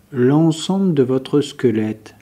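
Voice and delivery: ordinary delivery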